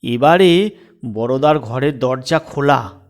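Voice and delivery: ordinary delivery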